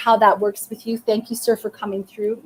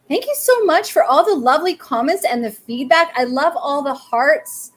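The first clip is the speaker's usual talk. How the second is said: bright excited thanks; 5 semitones higher, 5 dB louder than usual. Real, not acted. Thanking viewers for comments.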